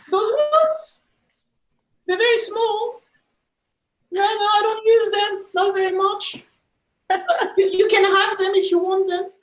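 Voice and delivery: high, loud put-on character voice; put-on bouncer voice. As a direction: play the silly bouncer refusing. Bouncer voice in comedy bit.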